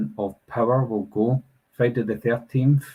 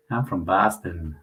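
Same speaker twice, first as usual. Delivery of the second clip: slow, put-on Boston accent; slow imitation of a Boston accent; 3 semitones lower than usual. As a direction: play a Bostonian, drawn out. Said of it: "people from Boston would say".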